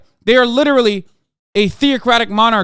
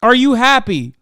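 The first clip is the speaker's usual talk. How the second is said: louder and higher, thrown at the chat; yell; 6 semitones higher, 5 dB louder than usual. Real, not acted. Streamer yelling at a chat troll, loud and higher. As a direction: snap the question back as a real yell. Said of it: "you made me yell at you instead".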